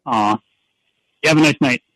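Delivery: ordinary talk, nothing marked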